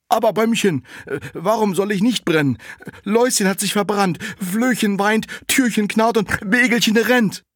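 the dung heap asks the running cart, high and loud; little dung heap. Acted. Dung heap answers the tree.

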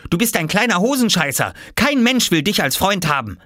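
high-pitched taunting; spiteful big sister. Acted. Jule insulting her little brother, spiteful. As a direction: hurl it as a squabbling sister.

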